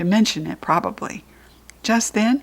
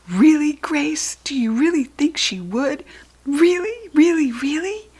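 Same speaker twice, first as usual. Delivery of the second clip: breathless, high-pitched, thrilled pleading; excited begging; 7 semitones higher than usual. Acted. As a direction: beg a friend, bursting with hope. Junie B. excited at Grace.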